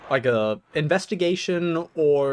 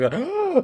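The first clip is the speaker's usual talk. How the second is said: high, sharp intake of breath; acted high gasp; gasp for air; 13 semitones higher than usual. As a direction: gasp as if finally able to breathe. Acts out gasping for breath.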